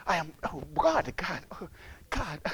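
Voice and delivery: low, soft feeble moaning; mock complaining. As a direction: imitate a sufferer groaning to God.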